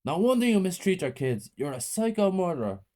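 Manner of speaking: high outraged accusation. A narrator's reading. Teacher to the mother, outraged. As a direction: condemn her with disgust.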